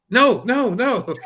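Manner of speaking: high, loud string of no's; urgent cutting-in. Acted. Acting out a lawyer stopping questions.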